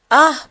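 sharp loud yelp of pain; pained cry. A narrator's reading. Swapna yelps as Kavitha twists her arm, loud pained cry.

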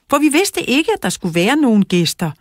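high, loud protest; shouting. A narrator's reading. Lara arguing with the boys over guests, shouting. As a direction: keep shouting the objection, starting high. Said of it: "råbte Lara".

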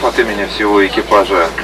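ordinary talk

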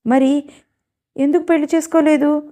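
surprised. Acted. Boy asks why mother refused.

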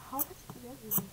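whispered in disbelief; amazed whisper to self; awed whisper. Real, not acted. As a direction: breathe it out in awe. Awed reaction.

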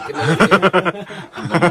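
drawn out through laughter; slow laughing repeat. Real, not acted. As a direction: laugh while slowly repeating it. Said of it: "All right, get my left ear".

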